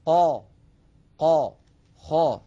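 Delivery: قا and خا said loud and heavy, the sound rising to the palate; emphatic letters demo. Shown as a demonstration. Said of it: "بينما على اليمين قا". (نطق مفخم للحروف المستعلية)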